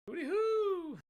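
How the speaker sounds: very high, loud hoot; silly call. Acted. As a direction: whoop it out like a goofy cry. Silly call out mid mic test.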